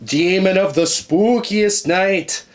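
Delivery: slow, solemn ritual chant; summoning incantation. Acted. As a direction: call the demons up slowly.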